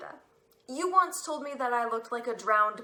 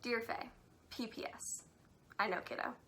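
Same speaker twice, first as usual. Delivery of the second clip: whispered aside; 2 semitones higher, 18 dB quieter than usual. Acted. Whispering a secret postscript to her little sister. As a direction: breathe the postscript out.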